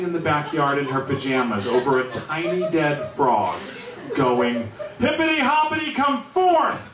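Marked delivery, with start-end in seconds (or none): 5.0-6.8 passionate calling out. Retold or imitated. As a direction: cry out to raise the frog from the dead. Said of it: "Really giving it her all, passionate".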